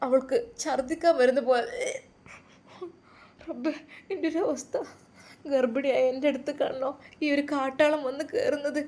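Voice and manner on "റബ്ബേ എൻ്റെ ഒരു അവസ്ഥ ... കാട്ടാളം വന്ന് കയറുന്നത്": higher, softer inward lament; plaintive, hushed plea to God; distressed woman. A narrator's reading. Nauseated pregnant woman laments to herself.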